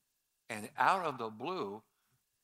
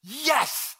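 loud, higher eager yes; joyful yes; 10 semitones higher, 8 dB louder than usual. Retold or imitated. Robert accepting Christ, loud joyful yes. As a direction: burst out with delight.

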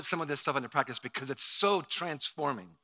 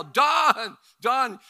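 loud, high shout of a name; retold yell; 9 semitones higher, 9 dB louder than usual. Pastor yells for a choking man's wife, re-enacted yell. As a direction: yell out to summon someone, re-enacted. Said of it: "So I yell".

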